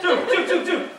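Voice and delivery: high, loud rapid repeats; mock breathless eagerness; excited urging. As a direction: pester a friend urgently. Acts out excitedly fetching friend.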